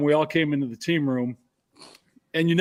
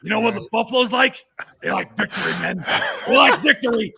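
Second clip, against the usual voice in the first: higher, building mock pep talk; mock coach speech rising to a loud finish; horrible coach impression; 5 semitones higher, 3 dB louder than usual. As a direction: ham up the coach's rallying speech. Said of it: "I'm gonna do a horrible impersonation".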